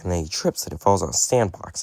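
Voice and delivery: ordinary talk, nothing marked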